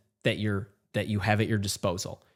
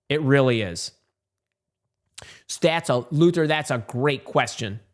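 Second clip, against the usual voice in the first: ordinary talk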